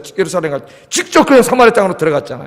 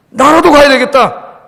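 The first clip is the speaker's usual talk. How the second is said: louder, slow, resolved resolve; decide firmly to go yourself; resolved decision; 6 semitones higher, 7 dB louder, slower than usual. Retold or imitated. Philip resolving to go to Samaria, firm and loud. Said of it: "그래서 빌립이 간 거예요".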